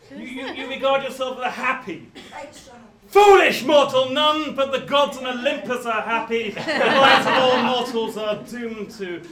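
loud, slow doom-laden declaration; slow theatrical villain menace. Acted. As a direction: boom like an oracle of doom. Tragic chorus scolds audience member.

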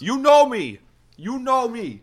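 high shouted catchphrase; loud shout. Acted. Host introduces guest, loud catchphrase.

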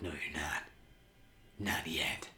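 low, hushed retort fading to a whisper; menacing quiet imitation. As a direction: act out a quiet threat, dropping into whisper. Acting out Harvey answering Gordon.

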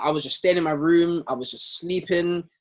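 ordinary talk

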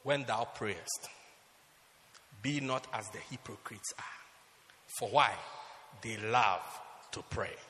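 solemn scripture reading. Retold or imitated. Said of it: "Jesus said".

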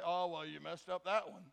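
low, soft mock put-down; low-voiced naysayer; discouraging critic. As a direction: grumble like a downer. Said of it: "the one to say".

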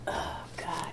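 breathy whisper of dread. Retold or imitated. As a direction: whisper it under your breath.

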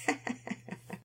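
quiet breathy chuckle; mother chuckling at her own joke; soft chuckle. A narrator's reading. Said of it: "Mama Peters chuckled".

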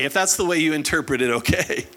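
ordinary talk

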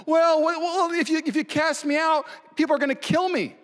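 mock a self-pitying murderer; whiny self-pity. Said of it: "what he shows sadness about? Himself".

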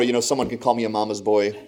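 usual speaking voice

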